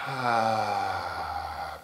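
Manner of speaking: long falling "ah" sigh; big sigh. Shown as a demonstration. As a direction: let out a big, audible sigh sliding down in pitch. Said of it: "avec un grand soupir".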